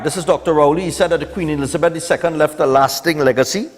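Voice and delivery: ordinary delivery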